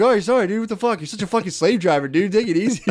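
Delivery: high-pitched, exaggerated pushback at a bossy cousin; mock protest. Crew answers the slave-driving cousin, mock protest. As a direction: act out whiny protest to the boss. Acting out the crew's protest to boss.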